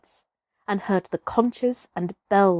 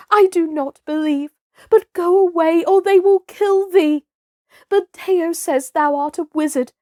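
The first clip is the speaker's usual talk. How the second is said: a higher, pleading mother's voice, lightly voiced; mild tearful cry; 8 semitones higher, 3 dB louder than usual. Messua pleading with her son to run, mild tearful cry. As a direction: beg him to flee, only a touch tearful. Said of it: "ran across to the herd and cried".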